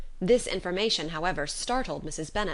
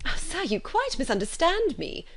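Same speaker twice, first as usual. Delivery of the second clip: higher, an alarmed mother in a hurry; 10 semitones higher than usual. A narrator's reading. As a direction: rush to correct him, flustered. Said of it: "said Missus Bennet, alarmed".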